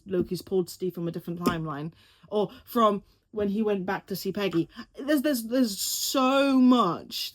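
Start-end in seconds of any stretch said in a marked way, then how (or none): 5.1-7.3 overwhelmed excitement. Real, not acted. Overwhelmed by theories.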